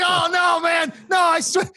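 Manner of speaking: squeaky, loud protest of innocence; pleading in a very high voice; high panicked self-imitation. Comic replaying his own frantic denial to Brian, very high and loud.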